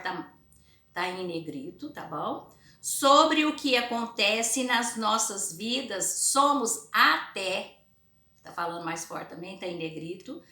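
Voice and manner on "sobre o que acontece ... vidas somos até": very slow, louder classroom reading; emphatic, drawn-out teacher read-aloud. Acted. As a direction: read it out word by word for pupils.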